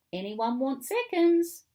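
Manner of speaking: loud, high, lifted question; calling out an offer. A narrator's reading. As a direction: call it cheerily to a room of children. Mum calls out offering cake.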